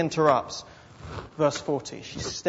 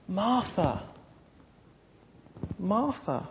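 gentle reproof; 3 semitones higher, 7 dB quieter, slower than usual. Acted. Jesus gently addressing Martha.

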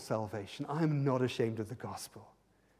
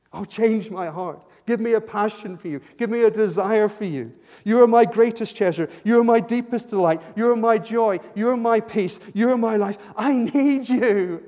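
much higher and louder, pleading; fervent preaching; 8 semitones higher, 15 dB louder than usual. Acted. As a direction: pour it out as an ardent plea to God. Fervent prayer-like crescendo.

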